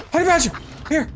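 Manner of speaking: excited call for an animal. Real, not acted. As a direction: shout the name across the field. Calling his companion.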